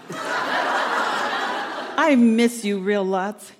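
drawn out very slowly through laughter; laughing. Real, not acted. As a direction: say it while still giggling. Laughs into the tape letter.

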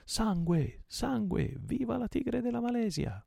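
high-pitched battle cry; war cry. A narrator's reading. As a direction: cry out high like charging pirates. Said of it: "si udì un terribile grido". (grido di guerra acuto)

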